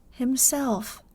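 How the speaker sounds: usual speaking voice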